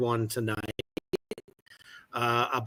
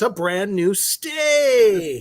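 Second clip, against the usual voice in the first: game show host; 7 semitones higher, much slower than usual. Acted. Joking game show prize line.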